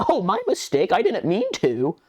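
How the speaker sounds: a scam bot faking an innocent mistake; mock innocent bot. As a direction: play dumb in a mocking high voice. Mocking the bot's fake apology.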